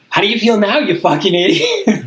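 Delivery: gloating jab at the robber; half-laughing mock threat; mock taunt.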